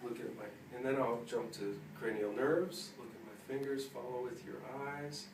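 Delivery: normal talking voice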